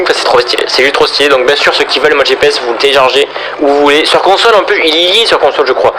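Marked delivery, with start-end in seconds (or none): none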